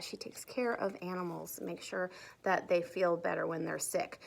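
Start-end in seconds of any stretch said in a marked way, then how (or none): none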